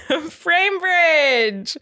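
very slow, stretched-out high cheer; laugh, then draw the name out long and high; playful big-reveal announcer call; hyped sponsor reveal. Pretend.

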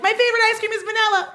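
high, fast grunted words; tennis grunt. Pretend. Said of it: "my tennis grunt would be".

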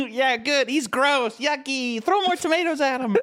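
mock cruel child, laughing; jeering kid. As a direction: taunt like a bratty child. Cruel crowd imitation.

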